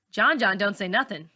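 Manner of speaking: plain reading voice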